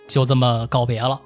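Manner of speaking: ordinary talk, nothing marked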